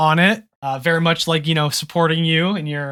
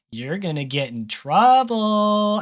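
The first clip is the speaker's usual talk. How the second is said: slow, higher, drawn-out teasing chant; taunting singsong; 4 semitones higher, slower than usual. Acted. Sister lurking in the doorway teases her brother, singsong taunt. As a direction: kid sister gloating in a singsong. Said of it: "you hear another voice at the door".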